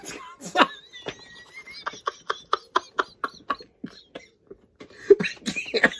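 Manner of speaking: wheezing words out through laughter; breathless laughing. Real, not acted. Reviewer trying to go on but wheezing with laughter. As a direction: barely get words out, whispered laughing. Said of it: "I can't keep a straight face".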